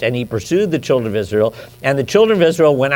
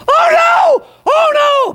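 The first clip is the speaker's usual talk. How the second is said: high, loud panicked shout; hammed-up panicking crowd; panicked cry; 8 dB louder, slower than usual. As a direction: cry out in sudden terror. Panicked Israelites crying out.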